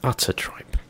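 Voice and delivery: whispered scornful repeat. Acted. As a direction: breathe it out with scorn. Repeating the insult.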